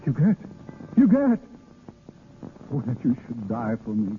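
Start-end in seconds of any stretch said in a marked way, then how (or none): 0.0-1.4 calling her name louder and higher; grieving over her body; anguished cry. Acted. She dies in his arms.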